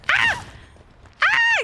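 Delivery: short, very high screams; startled shriek. Real, not acted. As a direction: shriek in sudden fright. Startled by killer.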